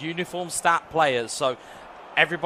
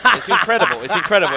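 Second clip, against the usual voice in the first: loud, high, laughing burst of amazement; laughing disbelief; 3 semitones higher, 9 dB louder than usual. Real, not acted. Caster laughing loudly at a voiceless fan in chat. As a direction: laugh it out, can't believe it. Chatter lost his voice, laughing.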